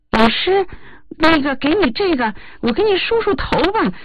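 高而稚嫩的童声; 学小朋友撒娇说话; 孩子声音. Retold or imitated. 模仿孩子说话. (模仿孩子的稚嫩声音)

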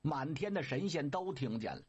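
usual speaking voice

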